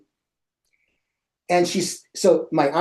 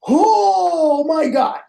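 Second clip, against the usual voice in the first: high, slow, breathy oh my God in alarm; drawn-out high cry of disbelief; shocked gasp; 11 semitones higher, 3 dB louder, much slower than usual. Retold or imitated. Seeing a long tail in his room, stunned slow cry.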